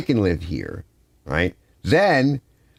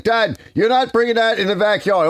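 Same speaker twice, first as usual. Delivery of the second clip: high-pitched daughter voice, a touch louder; imitating daughter; 12 semitones higher, 3 dB louder than usual. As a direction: play the objecting daughter much higher. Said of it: "she's like".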